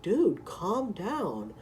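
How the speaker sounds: very slow, stretched calm down; slow drawn-out. Retold or imitated. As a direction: drag every word out slowly. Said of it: "I'm even like".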